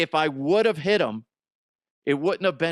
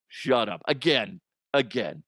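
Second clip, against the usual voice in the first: ordinary delivery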